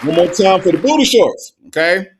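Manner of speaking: loud, high-pitched shout to the crowd; hyped call-out. Real, not acted. Host rallying the chat for booty shorts, hyped call-out. Calls out the encore after pause.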